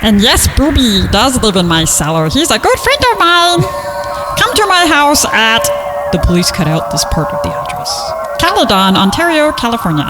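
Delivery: usual speaking voice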